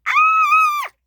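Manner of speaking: long piercing high shriek; very high, loud wail of fright; scream. Acted. Rosie terrified of the monster, long shriek.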